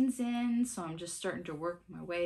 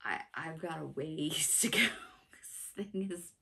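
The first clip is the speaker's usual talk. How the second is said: whisper; 3 semitones lower, slower than usual. Real, not acted. Stitcher daunted by huge pattern.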